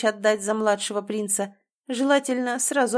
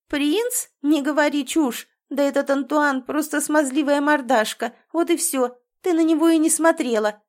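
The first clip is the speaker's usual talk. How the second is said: higher and louder, getting carried away; worked-up anger; 5 semitones higher, 4 dB louder than usual. A narrator's reading. Drizella berating her sister over the prince, heated rant. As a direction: rant hotly at your sister. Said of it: "разошлась она".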